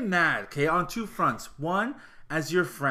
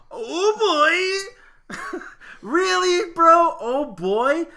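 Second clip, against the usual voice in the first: high, loud mock incredulity; hammed-up scornful imitation; mocking disbelief; 12 semitones higher, 6 dB louder, much slower than usual. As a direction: ridicule it with an exaggerated, pitched-up cry. Ridiculing the man's texted reply.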